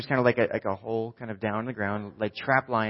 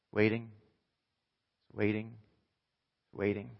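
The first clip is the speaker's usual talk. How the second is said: hushed suspense; 6 dB quieter than usual. Acted. Suspense before the answer.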